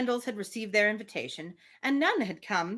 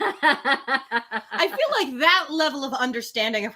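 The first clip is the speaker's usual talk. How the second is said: laughing; 4 semitones higher, 5 dB louder, slower than usual. Real, not acted. Laughing while making a point.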